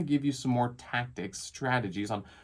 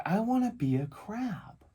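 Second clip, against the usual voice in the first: put-on slow, higher kid voice; imitated little boy; 8 semitones higher, slower than usual. Dad mimics his son confessing a wish, slow child voice. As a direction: draw out the wish like a small child.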